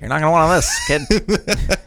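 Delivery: high put-on warning voice; tough grown-up. Adult warning a trick-or-treater off the drinks, mock tough. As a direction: ham it up as an adult cautioning a child.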